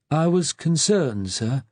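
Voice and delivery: a lightly voiced old clerk, slow and mournful; an old registrar gently rebuking a junior, slow and sad. A narrator's reading. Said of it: "in his slow, sad voice".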